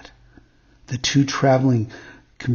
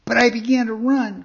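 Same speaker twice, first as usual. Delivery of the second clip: high-pitched little marionette voice; puppet; 9 semitones higher, 4 dB louder than usual. A narrator's reading. Puppet continues his tale, high puppet voice. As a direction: carry on as the puppet, pitched well up.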